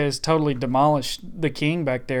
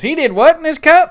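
high, loud hillbilly tow truck; Mater voice; 12 semitones higher, 11 dB louder than usual. Retold or imitated. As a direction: squeal it like Mater. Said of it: "that was a pretty good Mater".

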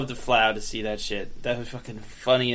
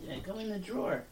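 cooing to a cat; 8 semitones higher, 14 dB quieter than usual. Real, not acted. Coaxing cat into drawer.

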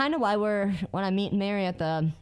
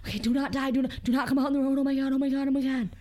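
higher, fast frightened pleading; mock panic while speeding home; panicked pleading; 6 semitones higher, faster than usual. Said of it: "I'm like".

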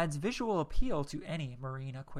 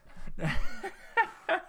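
high, breathless laugh; real laughter; 13 semitones higher, 3 dB louder than usual. Real, not acted. Reviewer cracking up at own Texan impression, real laughter. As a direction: crack up helplessly at your own act.

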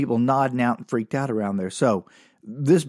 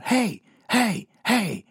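retold yell; 8 semitones higher, much slower than usual. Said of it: "starts yelling".

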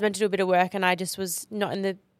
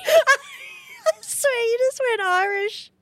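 much higher, slow, laughing through tears; real cry-laughing; crying with laughter; much slower than usual. Real, not acted. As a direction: barely get it out through laughter. Cry-laughing at the bad accent.

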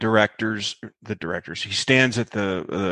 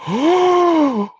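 much higher, louder startled oh; shocked gasp; 5 dB louder than usual. Retold or imitated. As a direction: imitate the crew's sudden shock. Everybody gasps at the fall.

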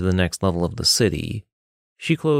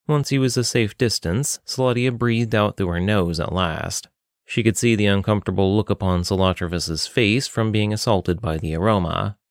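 ordinary talk

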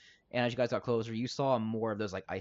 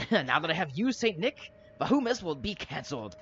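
raised, theatrical villain threat; gloating villain; 6 semitones higher, 4 dB louder than usual. Acted. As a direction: proclaim revenge higher and louder. Villain gloats over his captive.

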